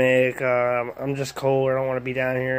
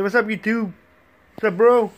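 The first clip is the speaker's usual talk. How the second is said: lightly voiced dog; voicing the dog; 7 semitones higher, 3 dB louder than usual. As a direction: put words in the dog's mouth.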